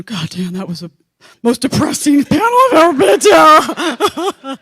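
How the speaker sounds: high, loud exaggerated sobbing cry; mock wailing. Imagined audience complaining about the panel, loud mock crying. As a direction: wail it slowly in fake despair.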